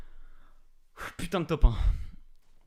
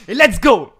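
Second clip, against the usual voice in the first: loud high shout; victory cheer; 7 semitones higher, 15 dB louder, much slower than usual. Real, not acted. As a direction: yell the win out. Celebrating the win.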